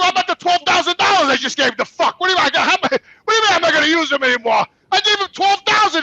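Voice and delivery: high, heated complaint; angry outburst. Retold or imitated. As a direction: rant in disbelief. Furious at cousin on phone.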